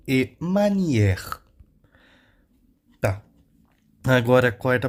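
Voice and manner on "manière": "manière" with a long, open è; French grave e. Shown as a demonstration. Teacher showing the grave accent, open French e. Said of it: "o grave vai dar o som de E".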